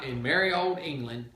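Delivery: plain reading voice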